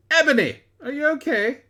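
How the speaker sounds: hammed-up worried friend; high sing-song fake concern; mock concerned. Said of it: "in a concerted voice".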